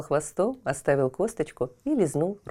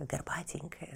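usual speaking voice

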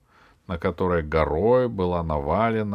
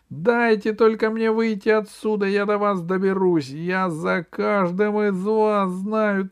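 high-pitched threatening tirade; furious yelling; 11 semitones higher, 4 dB louder than usual. A narrator's reading. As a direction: rant at the captors in a high, loud voice. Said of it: "кричал пигмей". (яростный крик с угрозами)

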